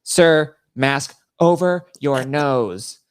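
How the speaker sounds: imitated TSA order. TSA lady to passenger ignoring her, imitated stern order. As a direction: call it out slowly, spacing each word of the order. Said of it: "the T S A lady was like".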